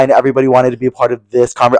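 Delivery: ordinary talk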